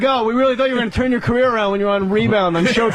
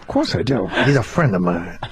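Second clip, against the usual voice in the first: low Pacino voice; 13 semitones lower than usual. Retold or imitated. Pacino impression, deep.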